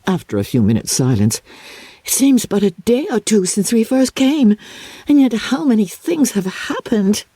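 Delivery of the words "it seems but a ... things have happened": put-on higher, eager young girl's voice; breathless with amazement at it all; young Maria marvelling in the carriage; girlish wonder. A narrator's reading. Maria marvelling after visit.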